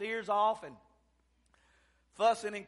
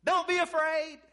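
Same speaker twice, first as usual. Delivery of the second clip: loud, high reassuring call as Jesus; preacher voicing Jesus calling out; crying out; 8 semitones higher, 7 dB louder than usual. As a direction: cry out across the water. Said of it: "Jesus cried out and said".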